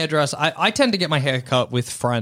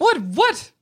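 very high, rising feigned bafflement; hammed-up innocent surprise; mock innocence. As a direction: squeak it as if baffled. Acting feigned innocence when challenged.

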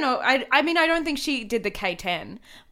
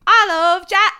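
put-on loud, high botched New Orleans accent; bad New Orleans accent; 8 semitones higher, 8 dB louder than usual. Podcaster playing a jazz lover, loud bad New Orleans accent. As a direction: shout it in a fake New Orleans twang. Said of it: "It's set in New Orleans".

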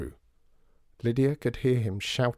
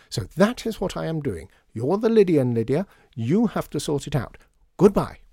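higher, louder, emphatic brush-off; angry dismissal; 6 semitones higher, 6 dB louder than usual. A narrator's reading. George Joseph sending Lydia away, angry dismissal. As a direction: hand over the problem and end it loudly. Dismissing her, she leaves near tears.

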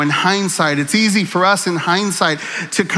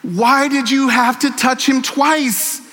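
high, pleading cry to God, swelling into a shout; 7 semitones higher than usual. Retold or imitated. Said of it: "I'm crying out to God".

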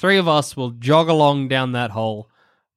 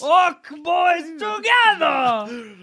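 very high, drawn-out rallying shout; rally the orcs in a hammed-up Italian voice; Italian-sounding orc; much slower than usual. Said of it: "Why are orcs Italian".

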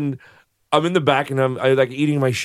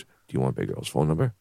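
low voice; 8 dB quieter than usual. Retold or imitated. Caller voiced in story.

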